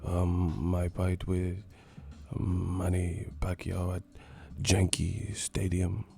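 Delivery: put-on low, slow accented murmur; mock hushed boxer interview; Miguel Cotto whispering. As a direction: mumble it haltingly, half under the breath.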